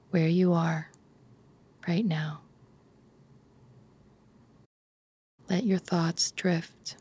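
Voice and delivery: calm bedtime relaxation, phrase by unhurried phrase; guided meditation. Acted. Leads a guided imagery relaxation.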